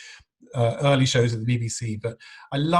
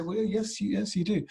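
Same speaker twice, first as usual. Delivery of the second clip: reluctant honesty; 8 semitones higher than usual. Retold or imitated. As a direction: admit it gently and awkwardly. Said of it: "you have to say".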